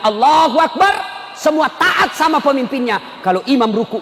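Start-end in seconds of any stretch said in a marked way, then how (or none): 0.1-0.9 imitating an imam's prayer call; imam's takbir. Preacher acts the imam leading prayer, projected takbir. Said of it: "Begitu imamnya bilang".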